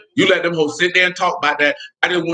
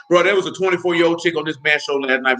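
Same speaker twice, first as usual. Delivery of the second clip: normal talking voice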